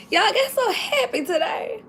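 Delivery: high, soft, tearful half-laugh; happy tears. Real, not acted. Vlogger telling viewers she got so happy, tearfully joyful. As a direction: voice overcome with real joy. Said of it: "you got happy tears?".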